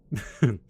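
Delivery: brief amused laugh; short chuckle. Acted. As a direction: let out a quick chuckle. Amused before reassuring assistant.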